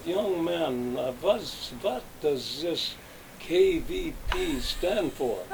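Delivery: put-on slow, lightly Yiddish-accented old boss. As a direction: rise and drawl it like an old Yiddish merchant. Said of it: "he had sort of a Yiddish accent".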